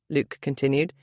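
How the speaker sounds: usual speaking voice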